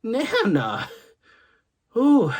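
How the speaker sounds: high-pitched whoops and protests; imitated disgusted child. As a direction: cry out as a little boy hit by a stink. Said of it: "he's like".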